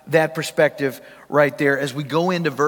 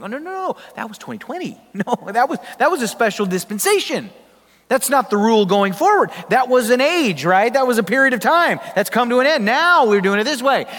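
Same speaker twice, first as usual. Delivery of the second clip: mock IRS agent; 6 semitones higher, 4 dB louder than usual. Preacher voicing IRS agents' reply.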